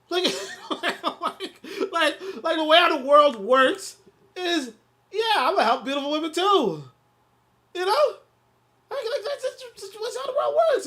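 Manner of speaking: laughing. Real, not acted. Laughing disbelief at friend's advice.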